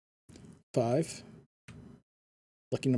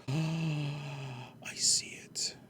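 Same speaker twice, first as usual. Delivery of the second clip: breathless whispered discovery; whispering; 9 dB quieter, much slower than usual. Real, not acted. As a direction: gasp and whisper it. Gasp at spotting maze.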